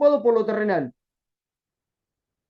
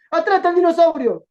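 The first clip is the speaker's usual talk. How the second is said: loud warning shout; 5 semitones higher, 6 dB louder than usual. Retold or imitated. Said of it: "dice el tipo".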